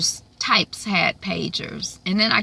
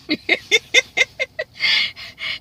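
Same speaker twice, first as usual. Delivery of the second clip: high laugh. Real, not acted. Reviewer laughing at her own dramatic read. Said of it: "It's like an audition".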